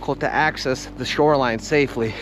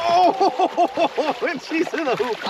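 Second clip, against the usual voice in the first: high delighted cry with laugh; thrilled as the fish is netted; fighting a fish; 13 semitones higher, much slower than usual. Real, not acted. Fish landed in net.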